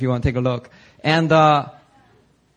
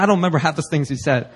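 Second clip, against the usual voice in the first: usual speaking voice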